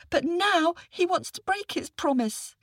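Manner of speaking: put-on high, earnest little boy's voice; a small boy calling for help; young boy. Boy complains.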